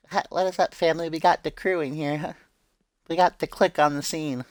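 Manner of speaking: high-pitched, chirpy hello to the crew; playful greeting. Pretend. Greeting arrivals in a put-on voice.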